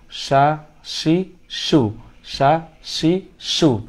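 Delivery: slow সা, শি, সু with clear vowels; slow drill of সা শি সু; slow syllable drill. Shown as a demonstration. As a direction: stretch each syllable for the class. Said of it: "তাহলে শিন যাব সা".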